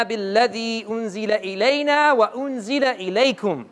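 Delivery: quick, flowing Quranic recital; fast recited Arabic verse; Quran recitation. Acted. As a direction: recite the verse in one rapid breath.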